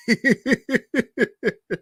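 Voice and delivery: high-pitched giggling fit; real, helpless run of high laughter; cracking up. Real, not acted. Host reacting to a customer's disbelief, cracking up.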